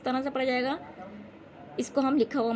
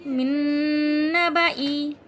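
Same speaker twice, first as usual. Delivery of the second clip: long held "मिन", louder chant; recitation demo; 2 semitones higher, 9 dB louder, slower than usual. Shown as a demonstration. Teacher reading the word without the alif, slow chanting. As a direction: recite slowly in drawn-out syllables. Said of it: "बिल्कुल नहीं खींचते".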